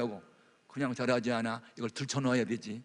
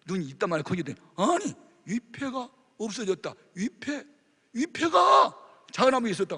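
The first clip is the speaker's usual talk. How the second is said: ordinary delivery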